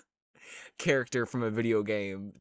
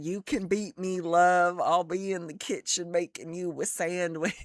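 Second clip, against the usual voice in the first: high, loud, sweet pretend wife; submissive housewife voice; 7 semitones higher, 4 dB louder than usual. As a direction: play a doting housewife. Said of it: "You just be like".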